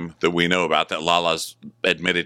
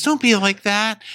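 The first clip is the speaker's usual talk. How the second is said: mock whiny Schwartz; 4 dB louder than usual. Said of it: "And he goes".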